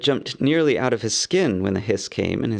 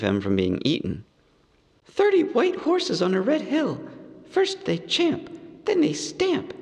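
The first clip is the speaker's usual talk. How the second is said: higher, careful riddling hobbit; nervous hobbit; 3 semitones higher than usual. A narrator's reading. Bilbo posing his riddle to Gollum, nervous. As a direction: recite the riddle anxiously.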